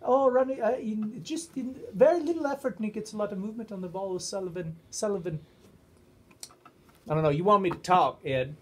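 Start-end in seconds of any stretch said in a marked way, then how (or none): 0.0-5.4 high, sing-songy Welsh commentator; Terry Griffith. Retold or imitated. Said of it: "Terry Griffith's commentating on that, but he can't say the O".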